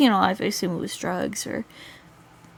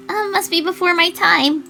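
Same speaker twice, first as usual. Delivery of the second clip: higher, louder character voice; lightly voiced girl; 9 semitones higher, 5 dB louder than usual. Girl shrugging off the old song, higher character voice. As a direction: pitch it up for the girl's teasing reply.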